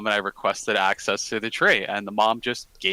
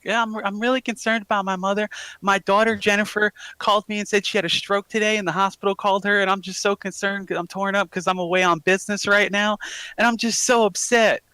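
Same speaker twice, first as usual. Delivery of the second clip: high Southern mom, feigning worry; hammed-up worried Southern lady voice; fake Southern woman; 9 semitones higher than usual. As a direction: plead as a concerned Southern mother. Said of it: "easier to fake a Southern woman's voice".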